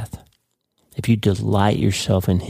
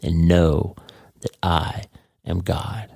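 solemn divine command; 2 semitones lower, slower than usual. Acted. Pastor quoting God in verse ten, solemn and halting. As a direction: set each phrase apart, gravely slow. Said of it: "in verse ten, he says".

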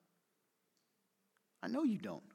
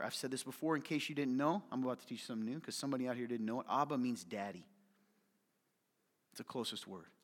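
ordinary delivery

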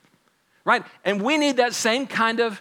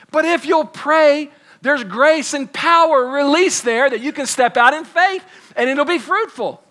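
loud, raised preaching voice; preaching at full force; 5 semitones higher, 3 dB louder than usual. Acted. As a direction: drive it home to the congregation, full force.

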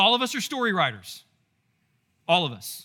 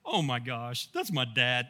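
low, quieter mortified aside; embarrassed seventh-grader; 8 semitones lower, 5 dB quieter than usual. Retold or imitated. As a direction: imitate a kid cringing at his dad. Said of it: "Everything's embarrassing when you're in seventh grade".

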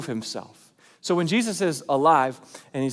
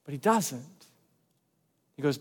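ordinary talk, nothing marked

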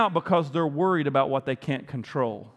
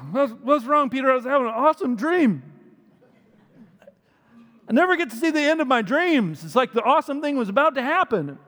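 lightly voiced sleepy, whiny Jesus, high and loud; a hint of a grumpy sleeper; groggy Jesus; 9 semitones higher, 5 dB louder than usual. Jesus woken from a dream, mildly whiny. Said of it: "And he's like".

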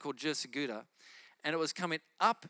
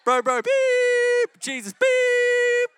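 high, loud beeping over the caller; loud, high bleep-riddled phone rant; censored caller; 13 dB louder, much slower than usual. Retold or imitated. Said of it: "It's like".

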